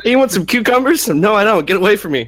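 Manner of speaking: high, loud mock squeal of disgust; mock disgusted refusal. Friend mocking his own hatred of the word, high disgusted squeal. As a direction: shriek it away like the thing is gross.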